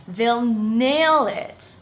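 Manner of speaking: slow clear repeat-after-me; slow model phrase. Shown as a demonstration. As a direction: say each word slowly for repeating. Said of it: "Let me hear you say it".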